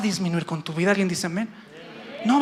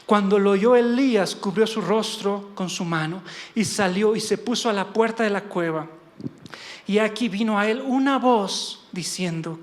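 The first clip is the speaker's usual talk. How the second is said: ordinary delivery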